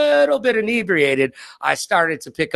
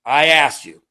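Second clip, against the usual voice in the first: normal talking voice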